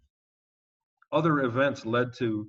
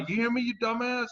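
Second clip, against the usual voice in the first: high, sharp mock yell from above; imitating an angry shout downward; retold yell; 11 semitones higher than usual. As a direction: yell it down at him. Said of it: "he yelled down".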